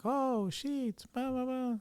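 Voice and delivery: high, far mock clerk voice; clerk's dismissive reply. Store clerk dismissing the question, high mocking voice. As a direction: brush it off like the clerk. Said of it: "the guy would be like".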